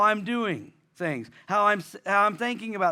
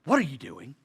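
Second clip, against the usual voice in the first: suspicious reading; 6 semitones lower than usual. Retold or imitated. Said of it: "How do I read that?".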